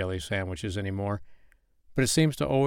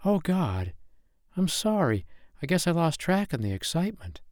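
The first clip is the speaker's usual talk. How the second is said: much higher, embarrassed rush of words; flustered apology; 9 semitones higher than usual. Acted. Woman realizing he is staring at her half-naked body, flustered. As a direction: caught out and blushing.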